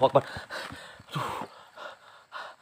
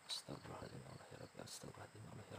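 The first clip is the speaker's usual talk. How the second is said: hushed frightened prayer; terrified whisper of prayer; 4 semitones lower, 25 dB quieter, much faster than usual. Real, not acted. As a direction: pray under the breath in fear.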